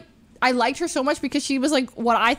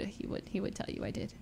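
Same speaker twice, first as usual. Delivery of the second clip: whispered aside; 3 semitones lower, 15 dB quieter than usual. Real, not acted. Confessing a broken heart.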